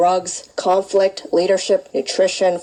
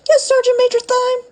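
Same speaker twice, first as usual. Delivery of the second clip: much higher, louder hurried correction; scared high reply; 3 dB louder than usual. Acted. Student fixing the rank after scolding, scared high reply. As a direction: squeak out the proper rank.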